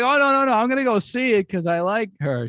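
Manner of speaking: fake-innocent backpedal that starts very high; mock innocent Norm. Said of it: "He's like".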